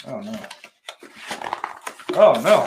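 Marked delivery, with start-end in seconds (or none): 2.1-2.7 mock panic. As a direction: ham up the dismay.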